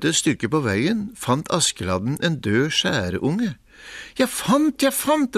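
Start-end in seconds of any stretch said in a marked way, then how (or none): 4.2-5.4 high, gleeful shout of discovery; excited call. A narrator's reading. Askeladden calling to his brothers, high and gleeful. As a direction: crow it out, thrilled. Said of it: "ropte han".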